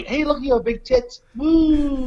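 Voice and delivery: high-pitched retold heckle ending in a whoop; mock catcall. Retold drunken catcalling at everybody.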